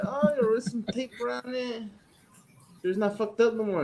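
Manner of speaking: a hint of a know-it-all worker; retold maintenance man. Repairman says he taped it.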